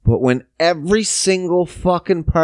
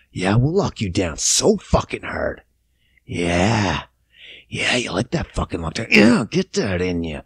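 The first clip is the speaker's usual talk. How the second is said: low, hammed-up lewd growling taunt; put-on leering dirty talk, pitched down; mock dirty talk; 7 semitones lower, 4 dB quieter than usual. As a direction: play it lewd and low, as if getting worked up. Parties acted as dirty talkers.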